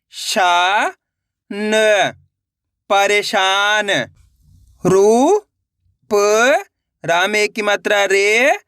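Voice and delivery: each syllable punched out alone, then the word drawn out slowly; slow sounding-out demo. Shown as a demonstration. Teacher leading students to repeat syllables, slow and emphatic. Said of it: "ये देखना है कि मैं किस तरीके से".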